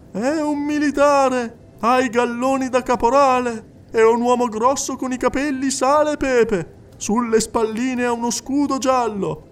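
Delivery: high, insistent clairvoyant addressing the hall; a medium coaxing a silent widow; medium's voice. Acted. Medium speaking to the crowded séance hall, high and insistent.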